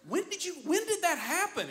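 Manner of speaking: high, laughing baffled question; mock-confused husband, laughing; clueless husband. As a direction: ask it like a stunned husband. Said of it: "I'm like".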